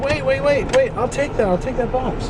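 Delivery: high, urgent repeated wait; eager shout-out to the girl with the box; urgent calling out. Retold or imitated. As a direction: call out fast before she crushes it. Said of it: "I said".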